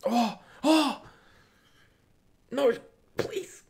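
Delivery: sudden high, loud gasping oh; shocked cry. Real, not acted. Streamer watching the match yells in shock at a chance. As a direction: yelp as the chance flies by.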